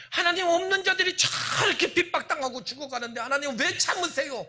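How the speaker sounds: high, loud anguished plea to God; Habakkuk's lament. Acted. Habakkuk pleading with God over injustice, high and loud. Voicing Habakkuk's desperate prayer.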